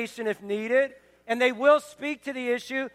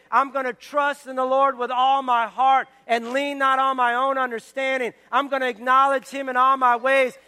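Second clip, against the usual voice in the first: preaching declaration; 5 dB louder than usual. Acted. Pastor urging the church to declare, full-force preaching. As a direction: build louder and louder into the promise.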